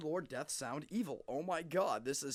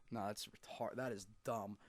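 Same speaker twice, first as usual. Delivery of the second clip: normal talking voice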